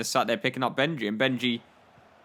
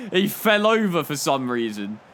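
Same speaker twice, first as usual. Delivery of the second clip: ordinary delivery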